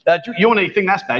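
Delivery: normal talking voice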